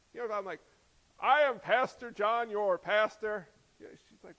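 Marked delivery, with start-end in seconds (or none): 1.2-3.5 slow, loud, pompous proclamation; hammed-up stately declaration; mock grand introduction. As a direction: announce yourself absurdly formally to someone who knows you. Mock self-introduction to someone who knows him.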